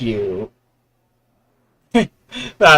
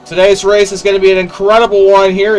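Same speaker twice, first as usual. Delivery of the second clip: motorsport commentator; 4 semitones higher than usual. Acted. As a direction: race announcer opening a broadcast. Race broadcast intro.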